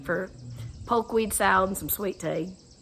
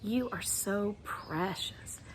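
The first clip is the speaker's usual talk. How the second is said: tender; 11 dB quieter, much slower than usual. Shown as a demonstration. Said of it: "So let's do that for you".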